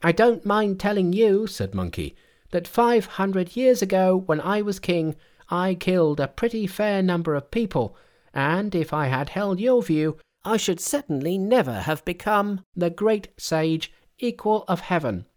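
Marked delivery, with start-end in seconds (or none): 0.0-1.5 high-pitched, self-satisfied monkey voice; high, cocky monkey king; boastful Monkey. A narrator's reading. 2.5-15.3 high-pitched, unrepentant monkey king; high, grand, self-important Monkey voice; boastful Monkey. A narrator's reading.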